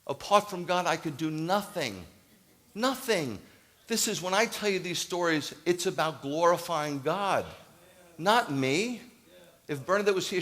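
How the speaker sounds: high, loud, slowed preaching peak; preaching at full force. Real, not acted. Pastor lifting the congregation to glorify God, loud preaching. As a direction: preach it out, soaring and insistent.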